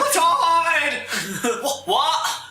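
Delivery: high, laughing surfer dude. Acted. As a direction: play the dazed, stoked surfer. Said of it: "I meant, like, surfer".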